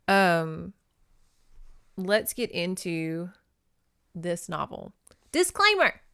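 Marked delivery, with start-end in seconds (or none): 5.3-6.0 mock announcement. Real, not acted. Announcing a disclaimer.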